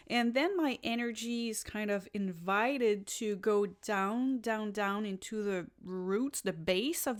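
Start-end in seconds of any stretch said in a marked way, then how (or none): none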